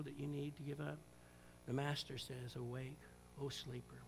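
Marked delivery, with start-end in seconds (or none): 1.7-4.0 1 hushed solemn call. Real, not acted. Quiet solemn close.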